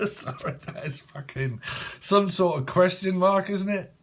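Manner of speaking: helpless giggles lifting the voice; real laughter. Real, not acted. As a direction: apologise while cracking up. Breaks up laughing, apologises.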